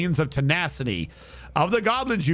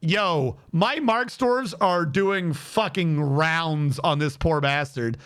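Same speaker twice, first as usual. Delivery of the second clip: normal talking voice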